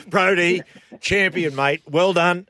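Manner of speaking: loud, high cheering praise; excited congratulations. Real, not acted. Host hailing the player's championship season, loud and excited. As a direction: shout it out like a proud fan.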